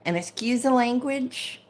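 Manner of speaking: normal talking voice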